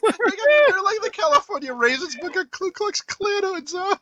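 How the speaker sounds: high, giggly description; laughing. Real, not acted. Cohost describing the raisin scene, laughing. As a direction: say it through laughter.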